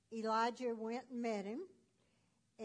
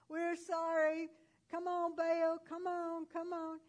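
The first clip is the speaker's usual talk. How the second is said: mock pleading; 7 semitones higher, 4 dB louder than usual. Prophets begging Baal.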